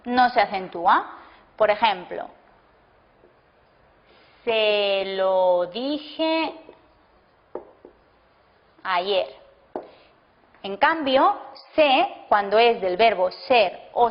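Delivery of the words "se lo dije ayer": word by word, with long gaps between words; slow dictation. Shown as a demonstration. Teacher to class, dictating an example sentence slowly. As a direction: dictate it slowly so students can write.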